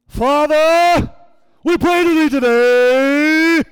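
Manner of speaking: mock the pastor's sudden God voice, slow and loud; imitating a yelling prayer. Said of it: "he has his God voice on now".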